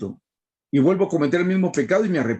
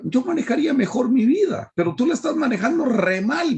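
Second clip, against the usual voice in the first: much higher whiny complaint; complaining to God; 7 semitones higher than usual. Retold or imitated. Preacher mimicking a sad believer reproaching God, high and whiny. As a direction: whine at God like an ungrateful child. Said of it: "Le estoy diciendo, "Señor".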